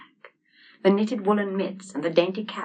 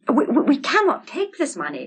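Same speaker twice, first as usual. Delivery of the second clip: panting; 9 semitones higher, 8 dB louder than usual. A narrator's reading. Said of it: "panted Hans".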